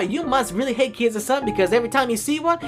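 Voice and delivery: ordinary talk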